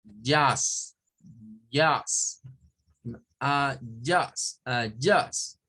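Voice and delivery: Yas said twice, then ah yas; word repeated. Shown as a demonstration. Teacher repeating the vowel for students. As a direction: drill the sound. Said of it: "pronunciar como un au".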